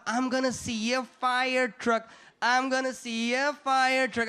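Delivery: put-on slow, drawn-out childlike wonder; excited kid. Kid about to see a first fire truck, excited. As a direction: stretch each word like an awestruck child. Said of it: "I was so excited, like a kid".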